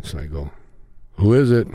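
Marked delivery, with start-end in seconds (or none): 1.2-1.7 lightly raised door call; calling through a door. Retold or imitated. Chong restarts the bit for the tape, calling out. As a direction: start the bit over, calling out.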